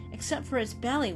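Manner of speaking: ordinary delivery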